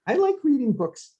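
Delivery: I like reading books in a sudden high voice; high mock voice. Shown as a demonstration. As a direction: squeak it out like a gushing fan. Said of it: "like this".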